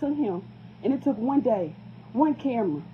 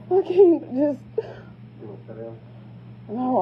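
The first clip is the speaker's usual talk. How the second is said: choked up. Real, not acted. Overwhelmed mother.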